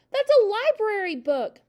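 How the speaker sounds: high, loud protest; crying out. A narrator's reading. Matilda protesting as father rips her book, crying out. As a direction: cry out in indignant alarm. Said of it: "Matilda cried".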